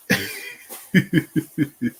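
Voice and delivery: real high chuckling laugh. Real, not acted. Host reacts to a viewer's comment, laughing. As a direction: giggle in a quick run.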